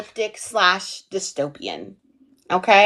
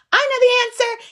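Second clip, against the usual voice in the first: high-pitched mock eager student; Nash; 13 semitones higher than usual. Teacher teasing a student who cheats, high mock eager voice. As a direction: pipe up like a know-it-all. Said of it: "So Nash is like".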